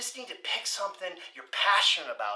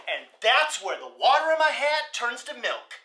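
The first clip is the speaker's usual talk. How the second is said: ordinary talk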